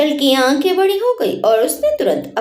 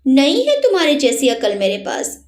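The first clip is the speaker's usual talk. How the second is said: plain reading voice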